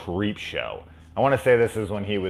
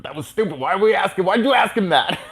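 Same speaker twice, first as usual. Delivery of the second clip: much higher, louder mortified complaint; embarrassed exasperation; 12 semitones higher, 6 dB louder than usual. Retold or imitated. Kid to brother after leaving, high exasperated. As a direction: scold brother in cringing disbelief. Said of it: "I was just like".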